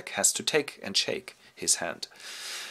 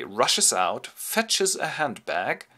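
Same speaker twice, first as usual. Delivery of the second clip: ordinary talk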